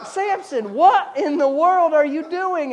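high, laughing exasperated scold; mock counselor. Counselor scolding Samson, laughing exasperation. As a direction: scold him through a laugh.

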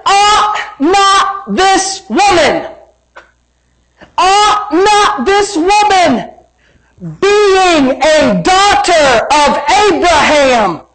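high, very slow, drawn-out declaration; ringing preacher's proclamation; forceful scripture preaching. Real, not acted. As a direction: stretch each word out for the congregation.

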